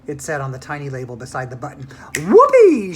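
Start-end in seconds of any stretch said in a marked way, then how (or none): 2.2-2.9 high, loud cheer; shouted. A narrator's reading. Mike grabbing the TV button, shouting with glee. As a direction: whoop it out like a thrilled kid. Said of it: "shouted Mike T V".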